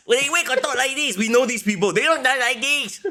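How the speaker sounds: high, silly caricature of online fans; mock chat-people voice. Teasing co-host about how chat talks, high caricature. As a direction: put on a high mocking voice for the chat. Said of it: "assume that the people in chat talk like this".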